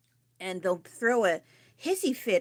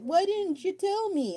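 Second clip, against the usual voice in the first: high, slow complaining family voice; whiny family member; 11 semitones higher than usual. Retold or imitated.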